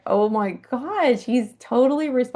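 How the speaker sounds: high, slow, awed exclamation; two friends marvelling at the moving snake, high and awed; amazed. Retold or imitated. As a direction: marvel at it, drawn out. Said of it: "we were just like".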